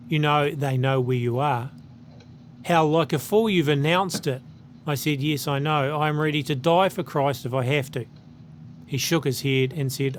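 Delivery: ordinary talk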